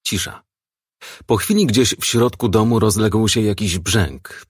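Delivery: ordinary delivery, nothing marked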